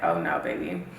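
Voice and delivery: very low, mock-sassy voice for her skin. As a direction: drop way down and sass it. Skin talking back, voiced.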